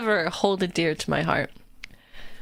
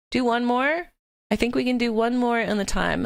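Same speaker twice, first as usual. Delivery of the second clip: ordinary delivery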